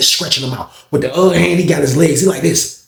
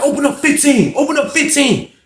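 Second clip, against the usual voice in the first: calling out; 12 semitones higher than usual. Retold or imitated. Officer calling to open cell.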